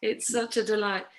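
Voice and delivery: ordinary talk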